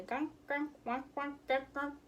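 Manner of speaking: playful small ducks begging for food; little duck quacks. Retold or imitated. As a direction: squeak it like a tiny duckling.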